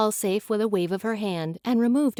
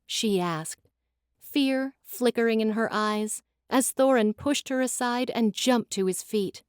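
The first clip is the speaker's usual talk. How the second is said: ordinary talk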